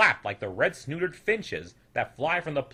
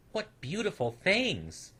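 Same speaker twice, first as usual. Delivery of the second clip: wonder; 7 semitones higher, slower than usual. A narrator's reading. Snoodle sees flowers.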